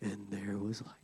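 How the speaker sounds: breathy, lifeless whisper of a dry scribe; mock the scribes with a limp whisper. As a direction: whisper it like there's no power in it. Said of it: "And they talk like this".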